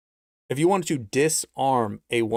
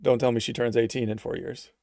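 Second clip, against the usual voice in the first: ordinary talk, nothing marked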